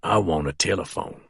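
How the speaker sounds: low, soft and unbothered; calm. A narrator's reading. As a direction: quietly demand the phone. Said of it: "Snake says calmly".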